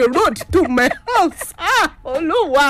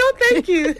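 higher, giggly mock-polite no; laughing refusal; 11 semitones higher than usual. Girl echoing friend's polite brush-off, higher and laughing. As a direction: tease it out through a laugh.

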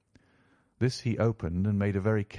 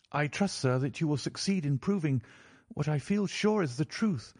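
put-on higher-pitched young lady, appealing earnestly; hopeful niece in a raised pitch; 7 semitones higher than usual. A narrator's reading. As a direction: appeal to the detective for your cousin. Mary questions, then appeals to Holmes.

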